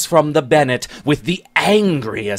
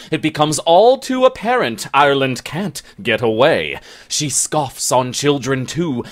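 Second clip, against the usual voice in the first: ordinary delivery, nothing marked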